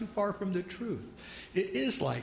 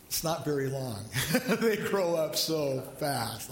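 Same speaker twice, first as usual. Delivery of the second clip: ordinary delivery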